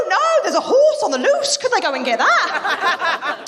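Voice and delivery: fluster it out then slow down; imagined plea to the doctor, high, flustered; exasperated plea. Pretend.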